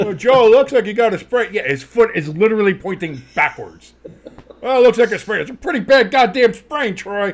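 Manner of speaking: loud high broadcaster voice; downplay it like a TV booth; mock commentator.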